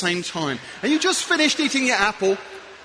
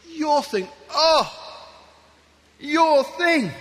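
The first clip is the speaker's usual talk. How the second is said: relishing your thing; 4 semitones higher, much slower than usual. Acted. Relishing the listener's passion.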